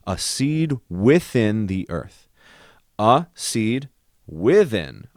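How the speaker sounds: slow, word by word reading of a seed within the earth; slow deliberate reading. Shown as a demonstration. Composer reading text slowly to find its rhythm. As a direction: read the text slowly, weighing each word. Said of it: "let's keep going".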